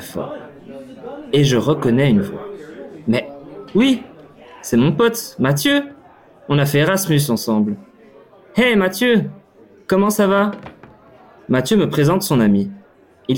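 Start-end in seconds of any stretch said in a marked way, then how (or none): 3.1-5.9 put-on as if spotting an old friend across the bar; delighted recognition. Narrator recognising his Erasmus friend, rising happy surprise. 8.5-10.5 high, bright call across the room; calling out a greeting. Retold or imitated. Narrator calling to his old friend Mathieu, high excited greeting.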